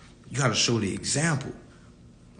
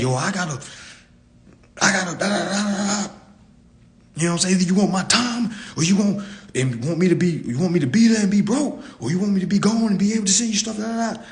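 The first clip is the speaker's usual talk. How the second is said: retold self; 6 semitones higher, faster than usual. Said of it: "I remember saying that to my kids".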